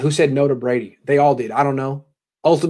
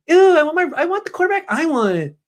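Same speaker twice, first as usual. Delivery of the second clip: very high, bratty mock whine; Kyle Shanahan; 14 semitones higher than usual. Host plays coach demanding his quarterback, very high whine. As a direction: wail it like a spoiled kid. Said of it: "Stop whining, Kyle".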